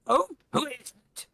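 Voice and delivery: high-pitched goblin woman; woman behind door. Acted. Goblin woman answers the knock at her door, high-pitched. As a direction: call out high from inside. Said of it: "you hear a woman inside go".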